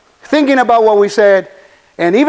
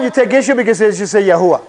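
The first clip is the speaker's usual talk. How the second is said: ordinary talk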